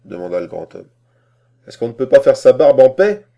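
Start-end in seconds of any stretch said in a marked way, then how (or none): 1.7-3.2 loud, higher-pitched indignant question; exasperated complaint. A narrator's reading. Master to servant, disturbed while shaving, exasperated. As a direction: protest loudly at being disturbed.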